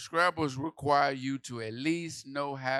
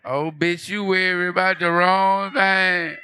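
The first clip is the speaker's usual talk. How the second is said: slow, high, loud laughing repeat; laughing shout; 8 semitones higher, 16 dB louder, slower than usual. Real, not acted. Comedian repeating the joke while laughing loudly. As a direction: howl the punchline through laughter.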